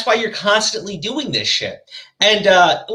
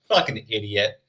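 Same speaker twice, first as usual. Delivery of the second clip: ordinary talk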